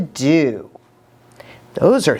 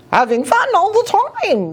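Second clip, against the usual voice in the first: high, fast laughing monkey; 13 semitones higher, much faster than usual. Acted. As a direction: giggle it out as the monkey. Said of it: "laughed Gogol".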